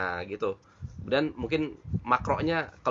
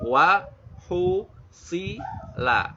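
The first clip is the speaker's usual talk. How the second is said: slow measured syllables; 3 semitones higher, much slower than usual. Shown as a demonstration. Teacher shows learners the right measure, slowly syllable by syllable. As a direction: spell out WA HUSSILA very slowly, precise lip shapes. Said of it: "itu segitu ukurannya".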